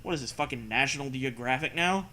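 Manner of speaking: ordinary delivery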